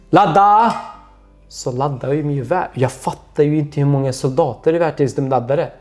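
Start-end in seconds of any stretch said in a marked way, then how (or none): 0.1-0.7 higher, louder barked order; shouted command. Retold or imitated. Officer commanding the firing squad, shouting. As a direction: bark the order to load. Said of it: "sen så säger han".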